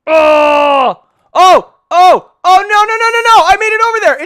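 loud high excited yelling; excited shouting. Real, not acted. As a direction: yell it out, thrilled and high. Tense jump then success.

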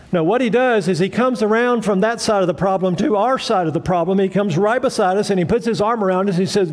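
ordinary delivery

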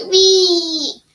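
high, loud happy wee; loud squeal. Real, not acted. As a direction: squeal with glee. Excited squeal.

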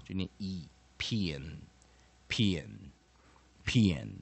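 pian said slowly, drawn out; weak-syllable pian. Shown as a demonstration. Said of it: "我们就念弱译音哈".

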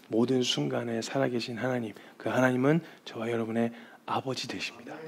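low, hushed pastoral voice; soft solemn exhortation. Real, not acted. Preacher invites those in despair to run to God, quiet and solemn. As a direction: bring the sermon down gently into prayer.

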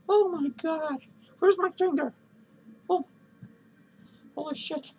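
ordinary delivery

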